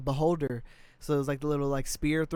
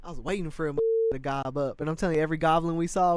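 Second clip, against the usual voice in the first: ordinary talk, nothing marked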